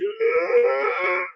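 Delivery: high hurt cry; pained yell. Retold or imitated. Getting up scraped after the crash, pained yell.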